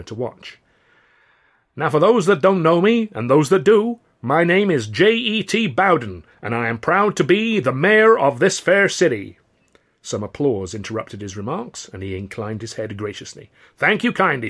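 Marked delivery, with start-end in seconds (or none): 1.7-9.4 high, loud Southern politician; showman's oratory; booming mayor. Acted. Mayor speech voice held. 13.8-14.5 high, loud Southern politician; showman's oratory; booming mayor. Acted. Mayor speech voice held.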